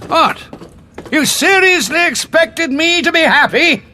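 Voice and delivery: indignant anger. Acted. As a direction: hurl the question back at her. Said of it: "you've got angrier in your old age".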